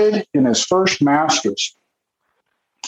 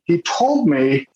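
ordinary talk, nothing marked

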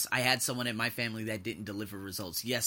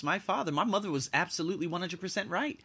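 ordinary talk, nothing marked

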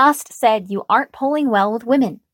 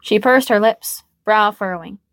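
ordinary delivery, nothing marked

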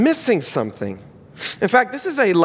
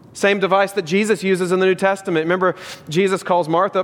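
usual speaking voice

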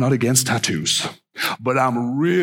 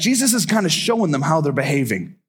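usual speaking voice

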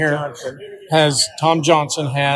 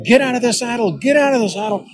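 high urgent calls to get out of the saddle; mild mock yell, pitched up; retold yelling; 9 semitones higher than usual. Ranch hands shouting at the rider stuck in the saddle, urgent high calls. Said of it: "And they're going".

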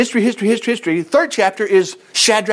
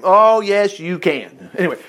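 ordinary delivery

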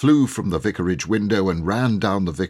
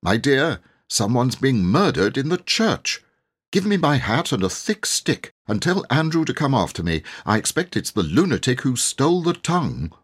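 higher, agitated fussing; flustered and urgent; alarmed vicar; 6 semitones higher than usual. Acted. Vicar telling wife of a murder, alarmed.

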